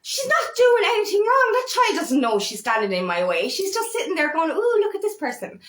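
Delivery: put-on rising to defend the little girl; indignant defense. Defending the child to the parents, high and indignant. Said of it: "And I'm like".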